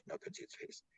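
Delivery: whispered, almost no voice; hushed whisper. Retold or imitated. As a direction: drop to a spooky whisper. Eerie hooded figure story retold.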